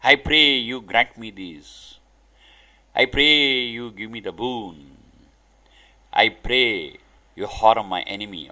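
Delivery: lightly voiced supplicant asking God for a boon; mock beseeching prayer. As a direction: imitate a devotee pleading for favours. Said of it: "we pray God, call him".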